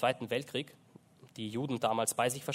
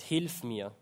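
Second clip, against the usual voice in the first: usual speaking voice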